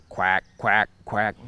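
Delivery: voicing quack like a hen mallard; duck quack imitation. Shown as a demonstration. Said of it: "you'll just hear the hen just quack".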